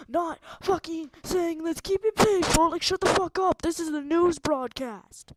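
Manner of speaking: yelling high and loud; really angry, shouting; furious shout. Real, not acted. Furious at chatbot.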